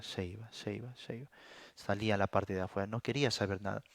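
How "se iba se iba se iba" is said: fading away. Real, not acted. People leaving one by one.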